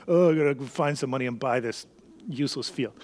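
low, grudging grumble; begrudging. Acted. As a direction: act put-upon and reluctant, voice sinking. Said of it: "he doesn't begrudgingly go".

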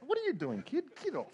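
put-on high-pitched comic protest at a pesky kid; mock annoyed player. Big player brushing off the skinny kid tackling him, high mock annoyance. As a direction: squeal it in comic irritation.

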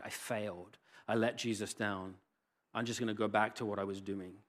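soft, resigned inner voice; quiet defeat. Acted. Peter going back to fishing, soft resigned voice. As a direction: sink into it softly, giving up.

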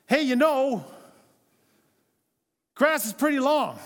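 lightly voiced spouse dropping a loud hint. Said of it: "She's just inferring".

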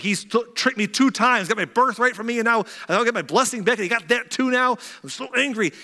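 high, loud outraged rant; acted anger. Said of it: "He was mad".

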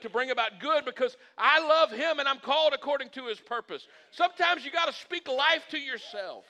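high, loud, driving sermon delivery; full-force preaching. Acted. Pastor declaring who God says he is, full-force preaching. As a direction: build declarations like a fired-up preacher.

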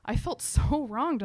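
usual speaking voice

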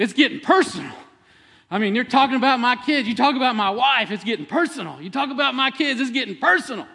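high, loud locker-room hype; fired-up coach. Retold or imitated. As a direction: pump the team up, shouting it high. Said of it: "And, man, he's working it".

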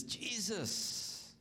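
"Jesus" cried out high and pleading; a frantic dad begging for help; desperate father's cry. Retold or imitated.

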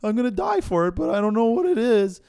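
high, loud, comically clueless voice; clueless Jesus impression. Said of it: "my Jesus impression if you didn't know what sin was".

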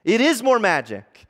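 big, emphatic storybook reveal; great voice. A narrator's reading. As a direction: announce it like a mighty being. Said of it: "said a great voice from behind their backs".

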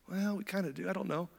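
small, high, very soft timid voice; squirming. Acted. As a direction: squirm and admit it under your breath. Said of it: "Nicodemus or Joseph were squirming".

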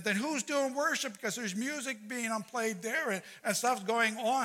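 high-pitched, fretful questioning; acted-out bafflement, pitched well up; worried protest. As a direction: fret aloud in a high voice. Pastor acting worried question.

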